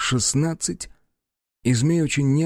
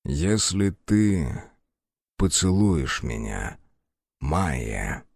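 low seductive whisper; 7 semitones lower, much slower than usual. Acted. Snake whispers seductive offer.

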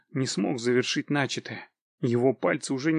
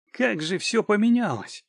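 high-pitched, mildly amused exclamation; 8 semitones higher than usual. A narrator's reading. Wounded man mocks the panicking captive, lightly amused. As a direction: exclaim it as if about to laugh. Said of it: "засмеялся я".

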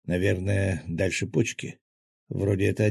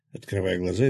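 plain reading voice

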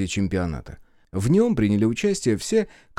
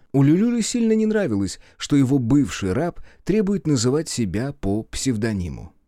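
usual speaking voice

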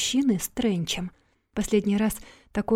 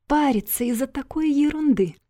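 higher-pitched, breezy dismissal; dismissive scoff; 6 semitones higher, 4 dB louder than usual. A narrator's reading. Yuna relieved at the trifling sum, scoffing. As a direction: wave the whole thing away, pitched up.

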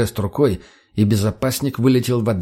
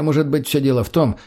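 ordinary delivery, nothing marked

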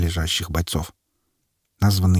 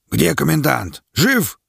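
barked question; 7 semitones higher, slower than usual. A narrator's reading. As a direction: bark it across the square. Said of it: "гаркнул".

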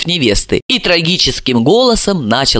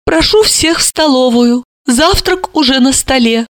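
higher, mildly mournful summons; sad invitation; 8 semitones higher than usual. A narrator's reading. Mother calling everyone to breakfast, mildly sad. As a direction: invite them with a touch of sadness. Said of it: "грустно призвав".

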